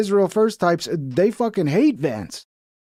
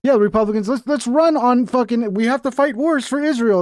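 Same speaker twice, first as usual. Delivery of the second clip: higher-pitched mock campaign pitch; sarcastic rallying; 7 semitones higher than usual. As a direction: cheer them on with obvious sarcasm. Sarcastic mock rallying cry to Republicans.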